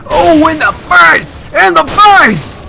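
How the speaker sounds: high, slow triumphant shouting; excited shout. Real, not acted. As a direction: yell it in victory. Hitting enemy with flare.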